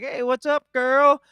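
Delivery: put-on high, loud girlfriend greeting; squealing hello to a friend; friendly greeting. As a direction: sing out a big hey girl. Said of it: "She'd be like".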